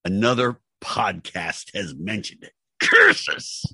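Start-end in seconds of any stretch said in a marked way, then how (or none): 2.8-3.7 high hissed cartoon villain cry; mock villain. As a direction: shake your fist like a cartoon villain.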